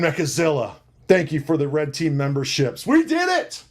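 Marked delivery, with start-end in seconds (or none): 2.9-3.6 very high celebratory cry; triumphant cheer. Real, not acted. Host cheering the end of the list, triumphant. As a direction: let out a high, elated cheer.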